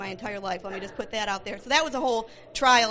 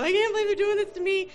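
retold crying; 8 semitones higher, 3 dB louder, faster than usual. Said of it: "I cry".

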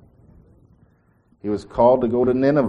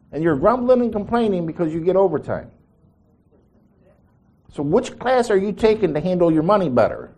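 retold scolding; 6 semitones higher, 4 dB louder than usual. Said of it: "I says".

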